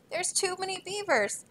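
high, worried sailor voice; fearful sailor. Acted. Sailor warns Trapper Stu about the overloaded boat, fearful. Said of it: "said the sailor with the fear in his throat".

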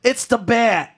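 high-pitched game henchman alarm call; henchman's yell. Acted. Game henchman spotting Batman, high mock yell. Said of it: "henchman in an Arkham game who yells".

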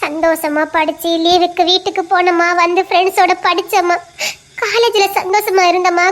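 ordinary talk

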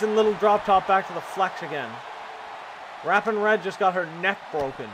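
normal talking voice

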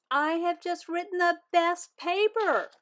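high, loud bragging; thrilled kid bragging on the phone; proud. Acted. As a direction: boast it like a star.

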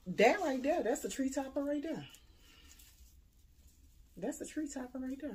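high, loud, thrilled boasting; bursting with pride over a find; excited showing off. Real, not acted. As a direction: show it off like a prize. Showing off the tree topper.

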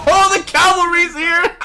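loud, high, laughing hype; excited cheer. Real, not acted. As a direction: whoop it out as help arrives.

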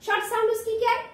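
normal talking voice

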